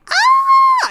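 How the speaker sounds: very high, loud delighted shriek; excited squeal. Retold or imitated. Retelling her reaction to his sweet text, loud high squeal. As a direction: squeal with giddy joy. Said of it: "I'm like".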